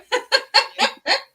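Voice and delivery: high-pitched burst of laughter. Real, not acted. Laughing at coincidence.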